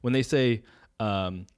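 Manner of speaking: ordinary delivery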